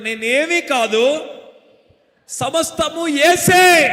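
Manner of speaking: slow, rising to a high loud shout on యేసే; shouted call-out. Shown as a demonstration. Preacher leads the church in a chant, building to a shout. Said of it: "ఇలా చెప్పండి".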